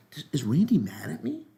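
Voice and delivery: imitating a worried whisper; mock whisper. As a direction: whisper it like a hurt churchgoer. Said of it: "They go".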